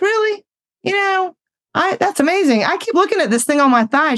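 high-pitched, chatty surprise; lightly acted high-voiced interest; retold amazement. Feigns casual amazement to esthetician.

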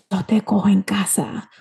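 menacing whisper in Spanish; whispered warning. Retold or imitated. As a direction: whisper it into a child's ear as a threat. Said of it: "lean over and whisper into".

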